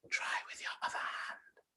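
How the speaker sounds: soft, breathy, hissing snake voice begging for help; a tiny frightened snake calling out in a whisper. Acted. Small snake begging the princess to hide it, hushed whisper.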